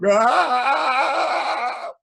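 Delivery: long, high, loud scream; fleeing scream. A narrator's reading. Storyteller playing people running out, long panicky scream. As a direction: scream like a crowd in flight.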